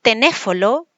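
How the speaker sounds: tenéfolo with the l and n swapped; child's swapped syllables. Shown as a demonstration. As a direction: say tenéfolo, mixing up the sounds like a child. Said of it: "O a veces va a decir".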